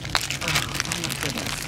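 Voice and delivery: almost under the breath, in wonder; hushed awe. Real, not acted. Opening the frame ornament from Cindy, hushed awe.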